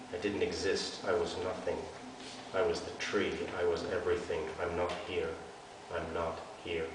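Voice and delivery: put-on a girl hiding from police, fading out; fading to nothing. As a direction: hide in the words, vanishing. Hiding, willing herself invisible.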